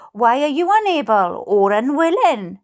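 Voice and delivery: ordinary talk, nothing marked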